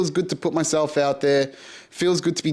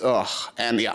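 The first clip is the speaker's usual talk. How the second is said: ordinary talk, nothing marked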